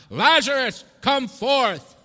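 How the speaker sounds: booming, high commanding shout; Son of God. Acted. Calling Lazarus out of the tomb, commanding shout. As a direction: call it out to raise the dead. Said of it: "hearing the voice of the Son of God".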